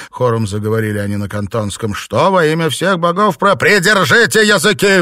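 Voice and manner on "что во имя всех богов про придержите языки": loud, high furious roar; roaring rage. A narrator's reading. Master silencing the chattering servants, roaring. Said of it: "взревел Филипп Чень".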